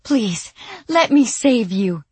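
high, gasping plea; begging. Acted. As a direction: beg desperately, near tears. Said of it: "Sandy gasped, begging".

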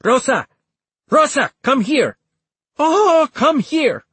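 high, loud, urgent calling; shouting. A narrator's reading. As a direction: shout for someone out the door. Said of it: "and shouted".

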